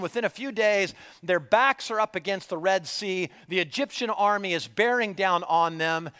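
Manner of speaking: usual speaking voice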